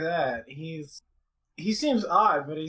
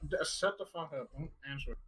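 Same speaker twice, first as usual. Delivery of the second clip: hushed; 10 dB quieter, faster than usual. Real, not acted. Player hushes another player.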